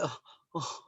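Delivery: soft, awkward little oh; a mock flustered client; embarrassed. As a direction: shrink away, embarrassed. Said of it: "they're embarrassed".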